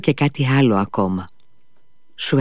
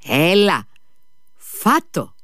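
high-pitched command to the dog; calling out a command; 7 semitones higher, much slower than usual. A narrator's reading. Kolia giving his dog the order to eat, called-out command. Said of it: "του φώναξε".

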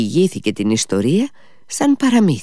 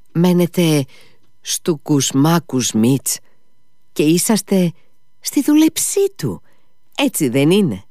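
eager questioning; 6 semitones higher than usual. A narrator's reading. Mitya eagerly questions the old servant.

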